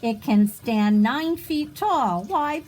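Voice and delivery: plain reading voice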